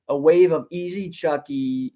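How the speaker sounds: ordinary delivery, nothing marked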